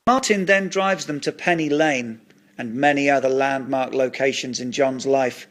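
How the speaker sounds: ordinary delivery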